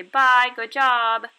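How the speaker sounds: cheery goodbye. Retold or imitated. Teacher saying goodbye to student, slow and loud. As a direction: stretch a big cheery bye. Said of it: "you say".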